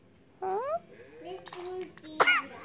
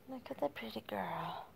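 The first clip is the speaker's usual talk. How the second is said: whisper; 9 dB quieter than usual. Real, not acted. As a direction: hush it, breath only. Whispering to baby.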